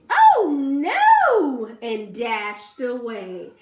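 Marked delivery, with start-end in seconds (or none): 0.1-1.7 loud high shriek. A narrator's reading. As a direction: shriek it in fright. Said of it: "they shrieked".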